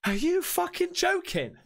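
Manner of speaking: high-pitched outraged disbelief; incredulous. Real, not acted. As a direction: challenge it in sheer disbelief. Disbelief at man welcoming threat.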